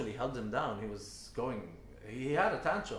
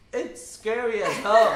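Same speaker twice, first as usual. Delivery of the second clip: loud, laughing exclaim; loud laughing with slow drawn-out words; laughing loudly; 9 semitones higher, 14 dB louder, slower than usual. Real, not acted. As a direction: laugh it out, mock-spooked. Fear at night, laughing.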